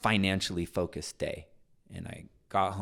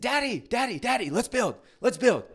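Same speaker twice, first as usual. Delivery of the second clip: put-on very high, loud excited child's chant; a kid bursting to build Legos; 7 dB louder than usual. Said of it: "he's like".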